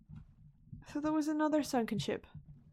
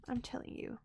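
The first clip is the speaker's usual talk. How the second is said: ordinary delivery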